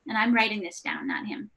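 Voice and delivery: ordinary delivery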